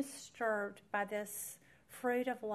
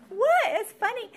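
incredulous; 13 semitones higher, 15 dB louder than usual. Retold or imitated. Retold exasperation, laughing.

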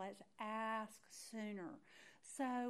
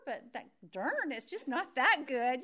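ordinary delivery

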